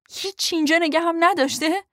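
shouted disbelieving question ending in a rise; hurt angry question. A narrator's reading. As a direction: demand it, wounded and loud. Hurt partner challenges her.

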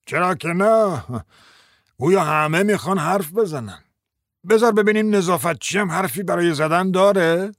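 put-on voice of a grumpy judge; grumbling judge. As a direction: grouse with sarcastic exasperation. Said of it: "غرغر کنان گفت".

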